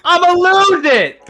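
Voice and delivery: high, loud exasperated threat; retold furious yell. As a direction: yell it like you're about to snap. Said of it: "I was so mad".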